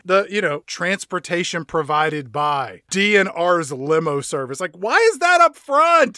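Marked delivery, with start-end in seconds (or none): 4.8-6.2 higher, louder baffled question; exasperated, raised-voice query; incredulous outburst. Real, not acted. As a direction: demand it in comic disbelief. Mock outrage at the credits.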